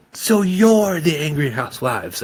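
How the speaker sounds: high, loud accusing howl. Acted. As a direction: howl it at them. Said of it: "I howled".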